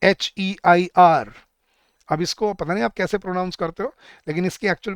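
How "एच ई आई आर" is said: slow, louder letter-by-letter spelling. Shown as a demonstration. Teacher giving students an example word, spelt slowly and firmly. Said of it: "एक word होता है".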